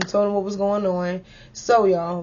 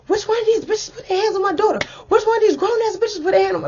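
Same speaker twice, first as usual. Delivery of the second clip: high, fast, furious imitation of her mother; mama going off; 12 semitones higher, faster than usual. Mother storms up demanding who hit her daughter, furious. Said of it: "my mother is going off".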